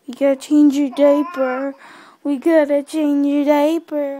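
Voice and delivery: loud soothing. Real, not acted. Mother to crying baby before a diaper change, loud soothing. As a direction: raise your voice, drawing the words out.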